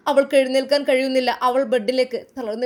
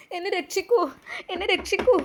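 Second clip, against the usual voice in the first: higher desperate call for help; crying for help; 8 semitones higher than usual. Acted. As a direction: plead to be rescued, pitched up. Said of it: "ശക്തമായി ആ വാതിലിൽ മുട്ടി വിളിച്ചു". (പേടിച്ച് ഉയർന്ന ശബ്ദത്തിൽ രക്ഷിക്കാൻ വിളിക്കുന്നു)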